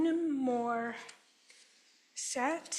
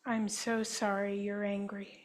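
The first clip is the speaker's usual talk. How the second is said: guided healing affirmation. Acted. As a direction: offer each apology gently, like a therapist. Statements for group to repeat.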